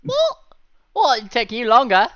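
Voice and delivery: high loud outraged squawk; indignant shriek. Real, not acted. Indignant reply to teasing.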